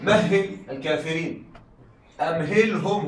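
slow, measured recitation with drawn-out words; Quran recitation. Shown as a demonstration. Teacher quoting a verse to the students, slow recitation. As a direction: recite the verse slowly, stretching الباطل. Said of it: "كذلك قال تعالى".

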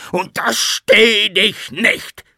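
very high, loud, slow threat; distorted enraged scream. A narrator's reading. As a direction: stretch out each word in screaming rage. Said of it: "wiederholte er und schlug mit der Faust". (langsam, schrill vor Wut geschrien)

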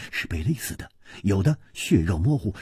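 plain reading voice

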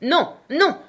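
high, loud refusal; crying out. A narrator's reading. The queen overrules the king, crying out. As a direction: cry out in protest, pitch up. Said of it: "s'écria la reine".

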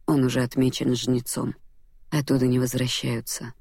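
plain reading voice

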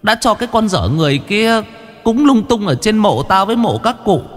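put-on high, aggrieved old woman scolding; uất ức trách mắng. A narrator's reading. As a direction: scold them like a wronged ghost aunt. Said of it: "Giọng điệu đầy uất ức". (giọng bà hồn ma uất ức trách mắng)

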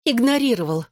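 ИГНОРИРОВАЛ pitched high, then falling; insistent contradiction. A narrator's reading. She contradicts his denial. («игнорировал» высоко, с нажимом, возражая)

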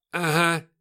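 loud, high mocking retort; насмешливое восклицание. A narrator's reading. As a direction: throw back loud disbelief. Сержант насмехается над пленным. (громкая издёвка)

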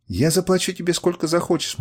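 urgent, imploring offer; pleading. A narrator's reading. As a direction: beg as a desperate captive. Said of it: "молила она".

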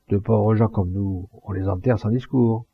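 ordinary delivery

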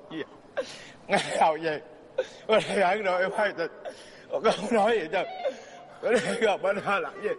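questions broken up by giggles; laughing through words. Acted. Mother giggling while questioning her daughter about gossip. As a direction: try to ask but keep cracking up.

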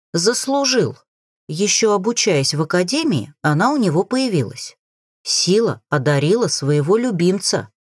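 ordinary delivery

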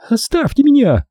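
high shrieking woman's cry; hysterical scream. Acted. Corola screams hysterically at the narrator. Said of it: "истерично завопила она".

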